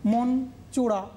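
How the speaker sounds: "মন চোরা" slowly, with a tiny break after মন; "মন", brief pause, then "চোরা"; pause between syllables. Shown as a demonstration. Teacher to pupils, showing the break between মন and চোরা, slow and deliberate. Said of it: "এখানে একটা বিরতি থাকছে".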